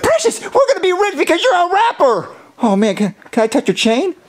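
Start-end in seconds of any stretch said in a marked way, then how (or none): 0.0-2.3 thrilled. Acted. Thrilled they will be rich.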